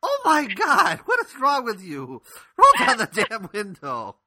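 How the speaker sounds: high mock-scolding tirade; imitated outraged grandmother. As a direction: imitate a disgusted grandma telling off her grandson.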